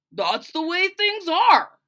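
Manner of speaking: slow, loud, final; gruff father. Acted. Father dismissing daughter's complaint, slow and loud. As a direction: shut it down, slowly.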